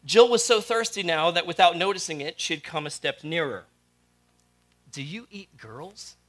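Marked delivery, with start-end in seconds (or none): 4.9-6.2 put-on soft, slow and small, a frightened girl asking; timid girl's question. A narrator's reading. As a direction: barely dare to ask it, softly and slowly. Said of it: "she said".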